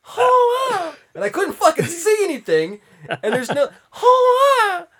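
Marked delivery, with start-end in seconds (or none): 0.0-0.9 high piercing hoa cry; quail call imitation. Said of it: "What the fuck is that noise?". 3.2-4.9 high, slow, hammed-up fright; mock scared. Retelling childhood fright.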